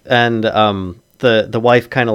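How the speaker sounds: ordinary talk, nothing marked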